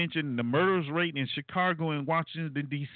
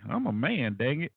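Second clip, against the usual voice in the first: ordinary talk, nothing marked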